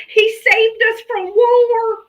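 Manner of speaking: high, slow, sneering mock voice; mocking voter. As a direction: parrot the talking point scornfully. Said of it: "come up and say".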